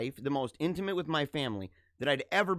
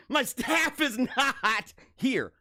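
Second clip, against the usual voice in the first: mock indignation; 8 semitones higher, much slower than usual. Mock complaint about absent staff.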